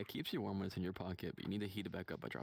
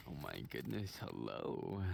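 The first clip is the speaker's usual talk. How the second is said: low voice; 9 semitones lower, slower than usual. Pretend. Low greeting voice.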